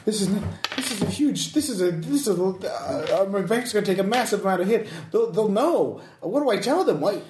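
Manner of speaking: high, rushed, fretting voice; a man watching his money vanish; panicking businessman. Acted. NPC panics about his bank.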